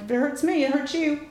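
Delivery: high, tit-for-tat comeback; retold mock-vengeful retort; defiant retort. Acted retort after biting him.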